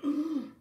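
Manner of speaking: sharp high gasp; mock shocked gasp. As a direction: suck in air in shock. Shocked reaction to threat.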